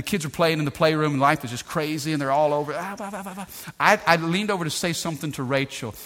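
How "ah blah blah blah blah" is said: soft, quick blah-blah babble; mock kid babble. Preacher imitating the kids all over the playroom, babbling. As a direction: imitate children's chatter in a light, softer voice.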